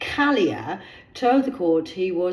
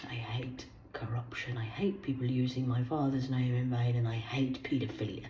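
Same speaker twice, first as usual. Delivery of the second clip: low, intense whispered vow; solemn whisper; 8 semitones lower, 11 dB quieter than usual. Acted. Declaring her hates to the viewers, intense whisper. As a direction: whisper each hatred like a sacred oath.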